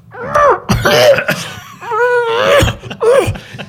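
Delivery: high, loud hammed-up retching gag; retching cough. Teller imitating Snuffy retching on stage, a loud hammed-up gag. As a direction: heave it out like a puppet about to throw up. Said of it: "he starts to go".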